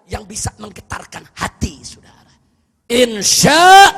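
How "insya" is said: loud, drawn-out call; rousing cry. Real, not acted. Preacher rallying the congregation, loud rousing call. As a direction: belt it out to the crowd.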